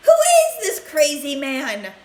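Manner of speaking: frightened grandma screaming at Wonka. A narrator's reading. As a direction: shriek at the stranger. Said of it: "screamed Grandma Josephine".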